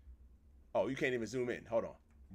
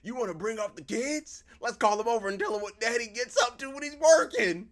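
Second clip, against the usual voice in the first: raised, louder quarrelling voice; acted angry spouse; 9 semitones higher, 8 dB louder than usual. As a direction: build to a loud, high accusation.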